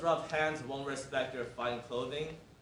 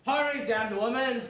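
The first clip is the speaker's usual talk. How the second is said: angry shout; 7 semitones higher, 5 dB louder than usual. Acted. Herald curses a captive.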